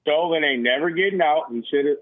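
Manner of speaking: usual speaking voice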